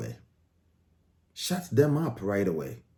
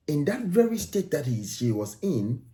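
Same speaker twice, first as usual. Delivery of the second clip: normal talking voice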